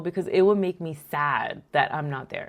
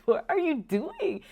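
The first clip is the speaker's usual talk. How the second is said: mock exasperated scolding; 11 semitones higher than usual. Mom scolds crying daughter.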